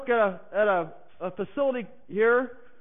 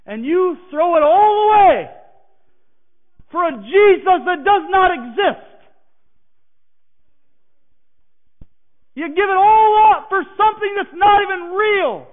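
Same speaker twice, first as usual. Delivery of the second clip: louder, higher contempt; angry scorn; 6 semitones higher, 8 dB louder than usual. Retold or imitated. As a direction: hurl the accusation at him. Hateful coworker mocks his faith.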